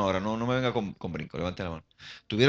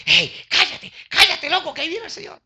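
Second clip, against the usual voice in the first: high, loud telling-off; scolding hush; 7 dB louder than usual. Acted. Servants shushing the blind man as the Lord comes, high scolding shout. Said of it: "los servidores y eso".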